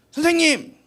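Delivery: high eager call to the teacher; loud eager student call. Retold or imitated. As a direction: raise a hand and call out.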